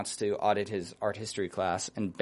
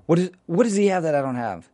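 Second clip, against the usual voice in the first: indignant whine; 8 semitones higher, 6 dB louder than usual. Retold or imitated. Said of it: "She's like".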